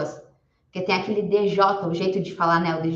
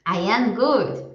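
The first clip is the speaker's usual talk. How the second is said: slow model answer; much slower than usual. Shown as a demonstration. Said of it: "Responde escrevendo".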